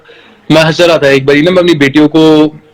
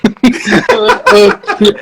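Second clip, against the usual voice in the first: high, hearty burst of laughter; full laugh at the memory; big laugh; 9 semitones higher than usual. Real, not acted. Laughing about son's games.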